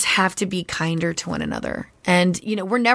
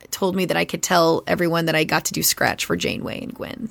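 usual speaking voice

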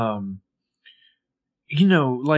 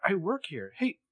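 indignant surprise; 12 semitones higher than usual. Retold or imitated. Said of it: "And Hannah's like".

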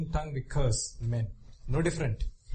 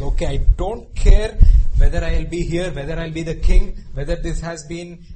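loud stubborn insistence; selfish demand; 9 dB louder than usual. Retold or imitated. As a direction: insist loudly you don't care. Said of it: "He's like".

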